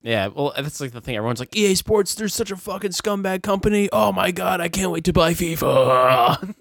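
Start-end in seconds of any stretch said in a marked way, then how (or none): 1.6-6.5 high mock rant tipping into gushing; imitating hypocritical fans. Mimics hypocritical fans, "Everyone's like.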